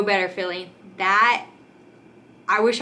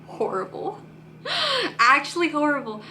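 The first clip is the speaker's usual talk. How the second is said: laughing it off; 7 semitones higher than usual. Real, not acted. Laughing about the painful tear.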